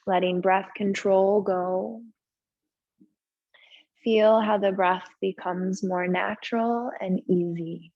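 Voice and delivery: unhurried yoga relaxation voice with long pauses; slow-paced, settled breathing guidance; guided meditation. Real, not acted. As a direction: ease them gently into stillness, leaving space between phrases. Leads restorative pose and breathing.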